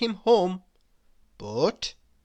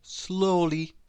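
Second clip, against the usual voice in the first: ordinary delivery, nothing marked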